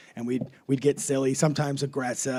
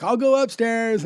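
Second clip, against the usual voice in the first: calling out; 7 semitones higher, 6 dB louder, slower than usual. Retold or imitated. Kid calling out to brother.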